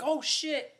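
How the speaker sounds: high, sharp startled gasp of a curse. Retold or imitated. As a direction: jump at the shout. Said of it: "she gets so scared".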